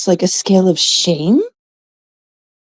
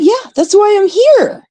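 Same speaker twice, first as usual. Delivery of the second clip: put-on much higher, sassy teenage comeback; teen self retort; 3 dB louder than usual. Teen answers grandfather about her weight, high sassy retort. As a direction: snap back like a fed-up teen. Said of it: "I went".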